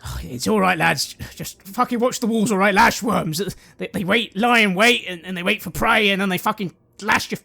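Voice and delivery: loud, gruff soldier reassuring lads; lightly voiced rough veteran; Kurt's voice. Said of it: "Kurt suddenly comes over".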